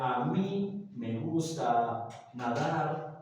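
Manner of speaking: said slowly, word by word; slow example. Shown as a demonstration. Teacher reads the example sentence slowly. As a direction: spell the example out for the class. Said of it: "Pero si yo digo".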